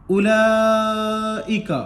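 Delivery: उलाइका chanted very slowly with the ला drawn long and the वाव silent; recited slowly. Shown as a demonstration. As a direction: stretch ला in उलाइका like Quran recitation. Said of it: "चार से पाँच अलिफ के बराबर खींचकर".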